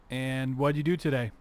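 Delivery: normal talking voice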